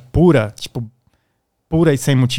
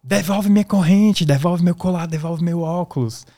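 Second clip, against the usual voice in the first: retold shouted accusation; 5 semitones higher than usual. Attackers yelling at him so neighbours think he's a thief, mock high shout. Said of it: "eles começaram a gritar".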